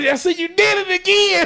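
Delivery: very high, laughing accusation; helplessly amused retold call-out; horse laughing. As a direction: squeal it out through laughter. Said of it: "I'm starting to start horse laughing".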